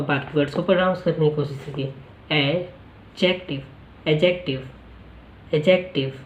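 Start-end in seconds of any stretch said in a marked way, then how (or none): 2.3-6.1 एडजेक्टिव said with the d silent, as "ejective"; एडजेक्टिव as "e-jek-tiv", no d sound; pronunciation demo. Shown as a demonstration. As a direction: say एडजेक्टिव without the d, first take slow. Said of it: "यहाँ पे डी silent है".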